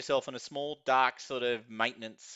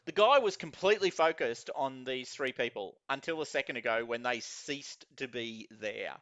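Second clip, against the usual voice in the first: ordinary delivery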